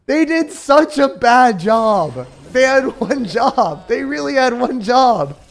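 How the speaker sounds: loud, high, slow and emphatic; exasperated rant. Real, not acted. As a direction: spell it out slowly in frustration. Ranting at the balance team.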